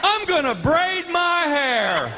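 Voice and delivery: high, slow dazed voice; stoned announcement. Retold or imitated. Drugged man on beach chair, high slow dazed voice. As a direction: float it out completely high. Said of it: "in that beach chair just going".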